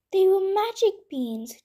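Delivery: put-on boy seeing the beanstalk, exclaiming; excited exclamation. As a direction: burst out in wonder. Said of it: "Jack exclaimed".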